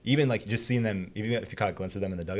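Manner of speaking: normal talking voice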